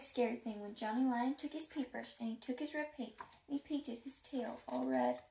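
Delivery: ordinary delivery, nothing marked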